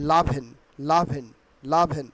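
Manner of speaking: "লাভেন" as la-bhen, falling each time; "লাভেন" with open "la", no opening vowel; preferred pronunciation. Shown as a demonstration. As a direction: repeat "লাভেন" starting straight on the L. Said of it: "choice করি সবসময়".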